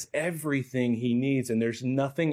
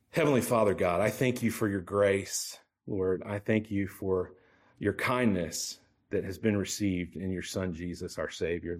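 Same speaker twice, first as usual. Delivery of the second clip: prayer; 5 semitones lower than usual. Acted. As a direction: pray humbly aloud for the congregation. Prays before the sermon.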